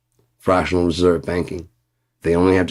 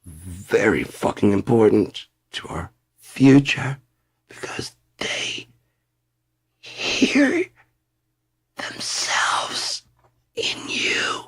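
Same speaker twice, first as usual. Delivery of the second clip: tearful whisper; 3 semitones higher, much slower than usual. Acted. Ranter confiding to voters, a mock tearful whisper. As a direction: hush it like you're about to cry.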